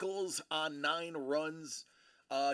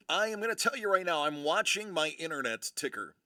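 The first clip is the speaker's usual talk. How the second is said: usual speaking voice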